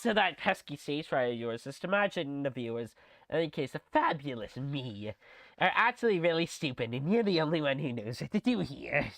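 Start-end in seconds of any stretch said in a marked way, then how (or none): none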